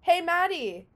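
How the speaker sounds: high, loud sing-out to a friend; mock calling out. Imitating friend calling her for a photo, calling out. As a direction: call across the room for attention.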